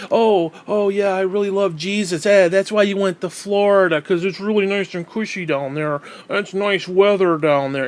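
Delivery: put-on gushing insincerity; sarcastic mock piety. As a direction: parody a phony devout pastor. Sarcastically voices the pastor.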